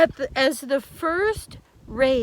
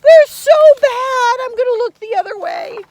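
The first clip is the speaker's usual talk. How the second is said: fast, loud, high mock-scared aside; mock fright; 10 semitones higher, 10 dB louder, much faster than usual. Storyteller pretending to be scared of the monster picture. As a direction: pretend to shy away from the picture. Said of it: "I don't even like to look".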